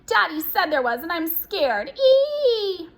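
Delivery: high scared squeal building to a shriek; continued squealing. A narrator's reading. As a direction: squeal in fright, ending on a long piercing eee. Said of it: "but continued to squeal".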